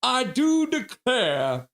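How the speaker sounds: hammed-up drawl. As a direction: ham it up like a stuffy old gentleman. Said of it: "That is hilarious".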